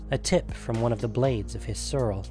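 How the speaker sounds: usual speaking voice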